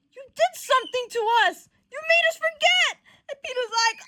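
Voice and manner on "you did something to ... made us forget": high, loud, climbing outburst; accusation. Acted. Johnny realizing Peter made them forget, high loud accusation. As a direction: accuse loudly, rising as it lands.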